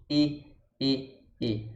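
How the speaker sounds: "E" with tongue against the teeth; spread E, tongue high. Shown as a demonstration. Teacher demonstrating tongue position, spread-lipped E. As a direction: repeat a closed, unrounded "E". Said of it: "encostando aqui nos dentes pra fazer o som".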